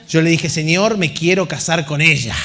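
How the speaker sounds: usual speaking voice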